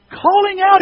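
high, loud ringing call; demonstrative full-voiced call. Shown as a demonstration. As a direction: shout the words to show their meaning. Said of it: "it says".

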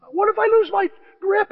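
high, loud panicked question; hammed-up terror, voice shooting up; freaking out. Player dangles on rope with pig, freaking out. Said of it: "he's freaking out".

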